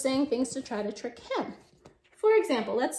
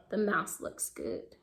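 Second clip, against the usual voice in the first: hungry owl; 7 semitones lower, 7 dB quieter, slower than usual. Retold or imitated. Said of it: "he says".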